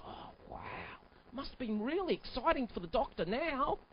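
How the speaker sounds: soft awed whisper; Henry in awe. Acted. Henry whispering to himself, mock awed. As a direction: whisper it, amazed. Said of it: "He goes".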